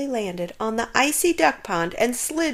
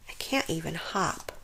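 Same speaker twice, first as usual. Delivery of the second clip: quiet despair; 5 semitones lower, 10 dB quieter than usual. A narrator's reading. Miserable tortoise thinking.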